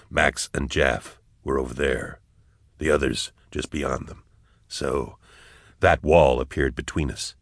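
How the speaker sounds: low, hushed soldier's voice; soft, low, composed reasoning; steady soldier character. A narrator's reading. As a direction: keep it low and measured, working it out. Character's line in narrated story.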